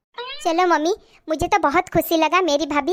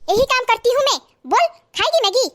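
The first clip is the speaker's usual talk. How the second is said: put-on sharp scolding of a child; angry scolding; 6 semitones higher than usual. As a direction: snap at the little girl. (गुस्से में डांटना)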